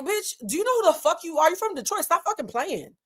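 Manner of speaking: high-pitched retold tough-love hype; hype-up pep talk. Friend reminding Stacey who she is, hyping her up. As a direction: imitate a friend firing someone up. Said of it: "like, hype up Mike Tyson".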